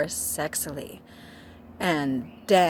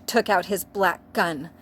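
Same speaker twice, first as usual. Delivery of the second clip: ordinary delivery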